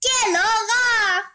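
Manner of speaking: high, loud cry of horror; horrified exclamation. A narrator's reading. Wolf sees his orange-peel coat, exclaiming in horror. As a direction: cry out in exaggerated dismay. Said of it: "et s'exclama".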